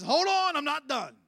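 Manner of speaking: God cutting in, booming and high; God's voice. Acted. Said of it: "And God says".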